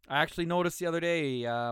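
ordinary delivery

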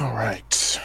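breathy whispered all right; whispered aside. Real, not acted. Player whispering an aside while dice roll, hushed. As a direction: whisper it off mic.

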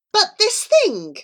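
high, drawn-out protest; appalled, stretched-out words; alarmed objection. A narrator's reading. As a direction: object in a slow, high-pitched panic. Charlie argues in alarm.

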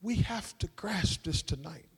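very soft, low, near-whispered close; solemn, fading almost to a whisper; hushed. Real, not acted. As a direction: drop to a low hush with long pauses.

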